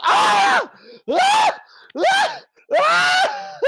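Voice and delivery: high, loud bursts of screaming; panicked screams. Acted. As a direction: scream in terror, over and over. Man startled by halfling in bathroom.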